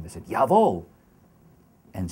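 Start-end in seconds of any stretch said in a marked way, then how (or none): 0.3-0.8 loud, high, barked "Jawohl"; Nazi soldier at attention. Retold or imitated. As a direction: shout it like a film soldier snapping to attention. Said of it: "When they stood to attention".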